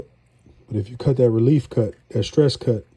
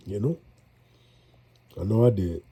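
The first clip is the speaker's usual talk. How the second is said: stuffy nose from a cold; talking through congestion; congested; 3 semitones lower, 4 dB quieter than usual. Real, not acted. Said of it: "as you could tell, I'm getting more congested".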